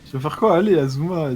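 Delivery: pushy, loud taunt; loud goading. Real, not acted. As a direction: dare them loudly to answer. Goading the other player.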